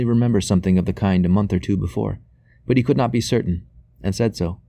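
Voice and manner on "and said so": ordinary delivery, nothing marked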